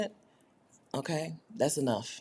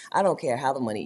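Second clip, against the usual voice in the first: ordinary delivery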